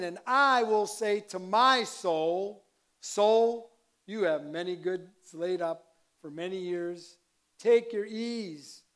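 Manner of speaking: slow, loud reading, stretching "I", "my" and "Soul"; stress on I, my, Soul. Shown as a demonstration. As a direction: proclaim it, leaning on "I", "my" and "Soul". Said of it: "I'll accent it".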